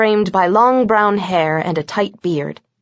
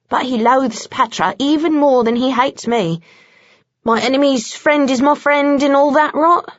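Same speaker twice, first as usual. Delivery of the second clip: lightly voiced irritated British vampire; a hint of a British sneer; Bones' voice; 4 semitones higher than usual. A narrator's reading. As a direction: grumble it like a fed-up Brit.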